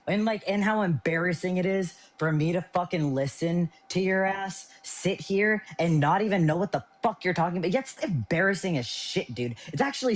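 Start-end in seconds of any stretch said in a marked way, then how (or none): none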